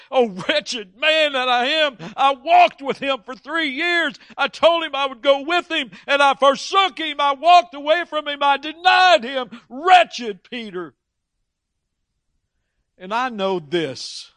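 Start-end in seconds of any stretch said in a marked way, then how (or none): 0.1-10.9 much higher, louder anguished self-reproach rising to a cry, slowing on the last words; wretched lament. Retold or imitated. Preacher imagining Peter grieving his betrayal of Jesus, much higher and louder anguished wail. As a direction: wail it out like a man who betrayed his friend.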